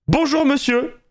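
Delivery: imitation aiguë d'une dame accueillante; accueil très sympa. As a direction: saluer avec une gentillesse exagérée, plus aigu. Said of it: "turbo sympa et tout". (ton très aimable, haut perché)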